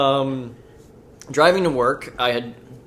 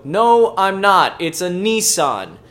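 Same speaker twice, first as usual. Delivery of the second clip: raised, then slow and firm; exasperated reply; 6 semitones higher, 6 dB louder, much slower than usual. Retold or imitated. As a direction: correct her with exasperation. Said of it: "And I go".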